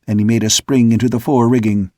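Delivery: usual speaking voice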